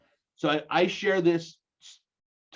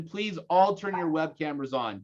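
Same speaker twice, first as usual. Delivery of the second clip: ordinary talk, nothing marked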